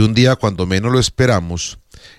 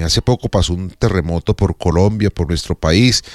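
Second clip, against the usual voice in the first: ordinary talk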